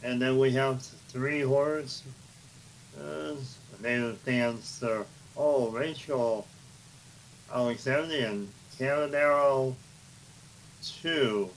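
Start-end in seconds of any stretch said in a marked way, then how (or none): none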